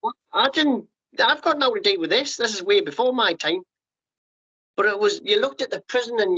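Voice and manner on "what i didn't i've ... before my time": retold indignant protest. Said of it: "you're like".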